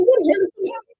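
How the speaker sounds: high, loud mock outrage; high-pitched indignant quote; mock Toya outburst. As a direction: squeal it like Toya losing it. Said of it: "Toya said".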